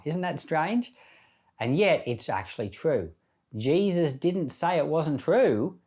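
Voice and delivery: ordinary delivery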